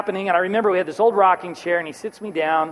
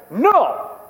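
yell; 5 semitones higher, 3 dB louder than usual. Retold or imitated. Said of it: "Yells at me right in front".

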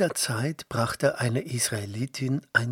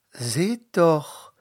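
higher, louder call; 5 semitones higher, 5 dB louder than usual. A narrator's reading. Princess calling to her servants, excited. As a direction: call out to the maids. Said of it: "rief sie".

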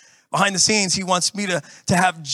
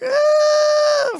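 very high, loud squeal; hammed-up delighted squeal; mock gleeful ooh; 5 dB louder than usual. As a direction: gloat at them passing by. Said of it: "and you're like".

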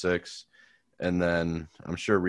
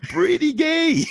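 very high, loud squeal; squeaky tease; 8 dB louder, slower than usual. Pretend. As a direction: shriek it back in mock disgust. Echoing the tease.

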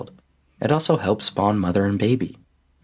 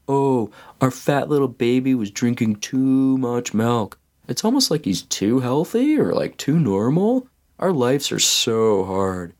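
drawn-out, higher-pitched sarcastic whine; mock whining; 4 semitones higher than usual. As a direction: whine in mock self-pity about a tiny problem. Mocking their own trivial complaint.

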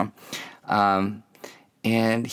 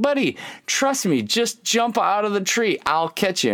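much higher, pleading coax; higher urgent coaxing; 9 semitones higher than usual. Retold or imitated. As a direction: beg the kid to trust you. Dad pleading with son.

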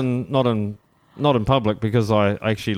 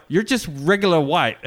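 higher-pitched imitation of a frank American local; retold blunt shopworker; 7 semitones higher than usual. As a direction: playfully mimic her dismissive verdict, pitched up. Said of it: "She's like".

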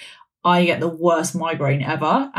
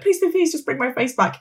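pleading; 8 semitones higher than usual. Retold or imitated. Begging in hospital.

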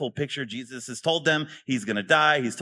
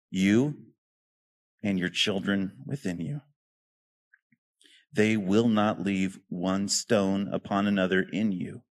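scripture reading; 6 semitones lower than usual. Acted. Pastor reads Jesus weeping over Jerusalem, low and hushed.

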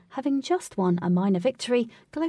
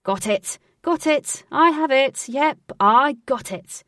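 excited, raised, repeated shouts; loud, noisy triumph; 5 semitones higher, 7 dB louder, slower than usual. A narrator's reading. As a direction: burst in calling out your success. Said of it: "Alec made a loud and noisy entrance".

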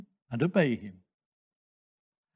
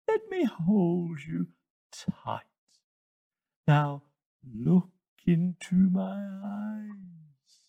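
very slow, high, with long drawn sibilants; hypnotic hissing snake; 6 semitones higher, much slower than usual. Acted. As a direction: lull the listener into a trance, hissing.

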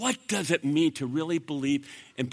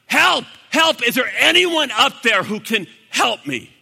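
loud, high-pitched calls upward; crying out for help; 7 semitones higher, 8 dB louder than usual. Retold or imitated. Jack hanging from a branch, crying out for help. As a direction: call desperately for rescue. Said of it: "he began to cry out".

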